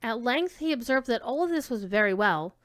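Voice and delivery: ordinary delivery, nothing marked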